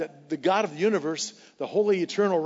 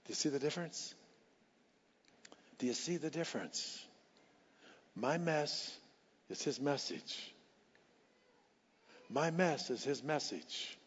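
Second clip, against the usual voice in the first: hushed refrain; 11 dB quieter than usual. Real, not acted. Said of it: "soft repeated refrain".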